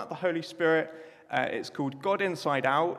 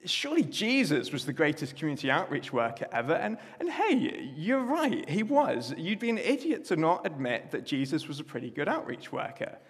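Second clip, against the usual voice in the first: objecting listener; 4 semitones higher than usual. Pretend. Preacher voicing a doubting listener, mock pompous. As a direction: play a pompous objector, stretched out. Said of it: "what you might also say is".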